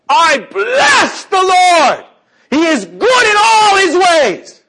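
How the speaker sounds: slow, high full-lunged shout; yell at the top of lungs. Shown as a demonstration. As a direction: bellow out the praise. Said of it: "at the top of your lungs, I want you to yell".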